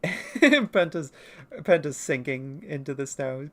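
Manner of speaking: ordinary delivery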